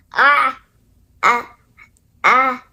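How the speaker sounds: plain reading voice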